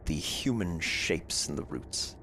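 eerie scene narration. A narrator's reading. Game master's scene setting.

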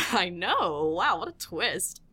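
ordinary talk

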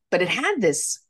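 ordinary talk

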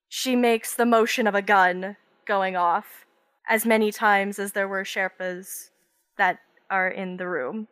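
usual speaking voice

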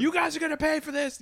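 fast, high angry threat. Retold or imitated. Mock angry dojo owner.

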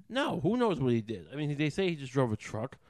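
normal talking voice

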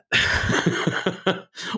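high breathy burst of laughter; amused chuckle at your own remark. Real, not acted.

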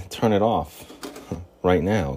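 ordinary talk

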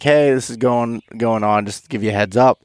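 normal talking voice

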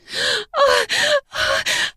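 long high gasp. Acted. Woman gasping at climax.

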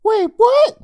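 put-on very high, rising confused kid voice; just woken and lost; groggy confusion. Brother jolted awake, very high confused question. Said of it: "still groggy and totally confused".

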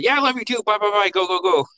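put-on high, impatient kid brushing off a goodbye; mock kid's hasty farewell; imitating hurried child. Child hurrying grandma off, retold.

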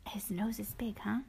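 whispered aside to the little one. Real, not acted. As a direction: hush it like a secret at bedtime. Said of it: "parent whispers aside to child".

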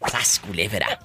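low, soft exclamation; grandmother's exclamation. Retold or imitated. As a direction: mutter it like an old grandmother. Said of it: "Así decía mi abuela".

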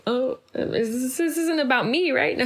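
high, hesitant imitation of a nervous co-star; comically wary checking; mock worried. Co-star worried by being screamed at.

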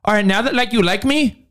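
high-pitched singer setting people straight; mock diva announcement. Host imitating singer correcting her fans, high and mocking. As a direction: announce it high, breaking into a laugh. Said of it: "then she's like".

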